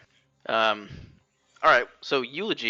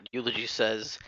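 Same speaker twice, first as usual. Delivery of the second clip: ordinary talk, nothing marked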